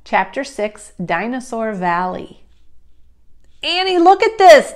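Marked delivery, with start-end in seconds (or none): 3.6-4.7 high, loud excited call. A narrator's reading. Jack calling Annie up the hill, loud call. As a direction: call across the distance to show a find. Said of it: "Jack called".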